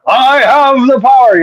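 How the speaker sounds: high, slow, booming hero shout; He-Man battle cry. Retold or imitated. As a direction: raise the sword and bellow it. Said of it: "go all Castle Grayskull".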